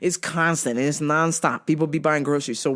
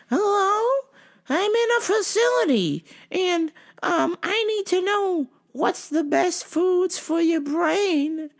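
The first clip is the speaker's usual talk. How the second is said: confused customer; 13 semitones higher than usual. Retold or imitated. Caller in a facility asking questions, confused customer voice. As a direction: keep it high, repeating as a confused caller.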